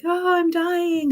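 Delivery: put-on milk the fall like a dramatic little kid; kid's wail. Child who fell on the playground, hammed-up high wail. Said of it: "the kid's like".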